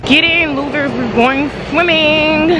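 high, slow bossy quote; high drawn-out sassy announcement; mock Mean Girls line. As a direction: ham up the queen-bee invite. Quoting movie line to camera.

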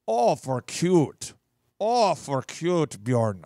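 put-on very drawn-out Minnesota accent; hammed-up Minnesotan, stretching every vowel. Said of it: "it's like a Minnesotan accent".

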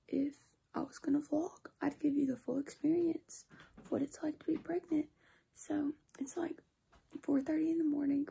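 soft unvoiced whisper, keeping quiet at night. Real, not acted. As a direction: whisper so no one wakes up. 4:30 am whisper while others sleep.

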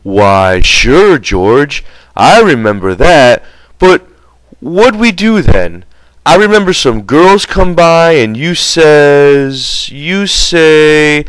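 high, slow, simple-minded voice, eager to remember; Lennie, eager and childlike. Acted. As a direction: play the big slow friend, lifting each word up hopefully.

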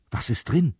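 ordinary delivery

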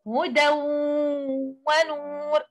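هدى ونور joined, tanween merged into the و and nasalized; idgham with ghunna. Shown as a demonstration. Teacher modelling the idgham rule for students, nasalized merge. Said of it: "Do you hear that?".

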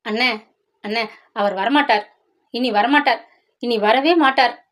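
ordinary delivery

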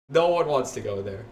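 normal talking voice

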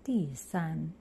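ordinary delivery

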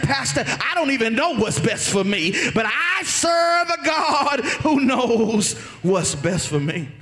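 loud, rousing pulpit proclamation; loud preacher stretching out the key words; full-force preaching. Acted. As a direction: preach it out hard to the congregation.

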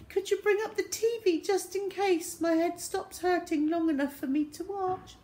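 put-on high-pitched poorly-child voice; feigned sickly whine. Boy asking mum for the TV, whining weakly. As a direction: plead pitifully, playing ill.

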